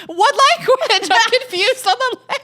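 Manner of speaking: high, loud incredulous laughing protest; high-pitched amused outburst; laughing disbelief. Real, not acted. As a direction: squeal it through laughter, baffled.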